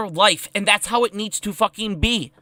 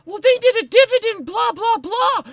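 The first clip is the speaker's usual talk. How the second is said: much higher mock voice trailing into blah blah; mocking GameStop fan; 13 semitones higher than usual. Mimicking a smug GameStop fan, high and mocking. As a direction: squeak it out dismissively. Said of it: "And then GameStop".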